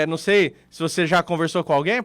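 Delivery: ordinary talk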